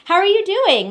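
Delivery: high, bubbly with a laugh; excited. Real, not acted. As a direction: cheer it out brightly. Bubbly restart after calm intro.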